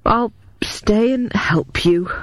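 slow, worn-out resignation; wearily. A narrator's reading. Mycroft yields to Hades to save the poem, slow weary voice. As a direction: give in, tired and beaten. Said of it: "said Mycroft wearily".

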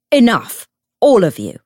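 loud, forceful call for order; booming order over a quarrel; commanding shout. A narrator's reading. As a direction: shout the room into silence. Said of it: "shouted Bridgestock".

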